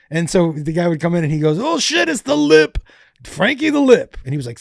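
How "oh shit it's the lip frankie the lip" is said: high, mock-excited holler; playful high-pitched announcement; teasing greeting. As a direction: hail him with mock delight in a raised pitch.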